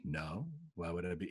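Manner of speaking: soft, low, emotionless reply; deadpan, no emotion. Retold or imitated. Agent denying he is joking with client, deadpan. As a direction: answer completely deadpan. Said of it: "He was like Stephen Wright".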